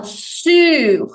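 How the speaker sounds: "sur" with rounded U lips and an I sound, final R heard; French U. Shown as a demonstration. Teacher models the sound, slow and deliberate. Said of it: "lábios de U, som de I".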